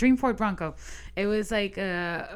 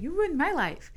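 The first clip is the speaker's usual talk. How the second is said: echoes her line back, pitched higher; the man parroting her accusation, higher; mocking echo; 7 semitones higher than usual. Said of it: "And he's like".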